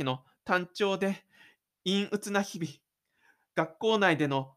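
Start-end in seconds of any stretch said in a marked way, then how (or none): none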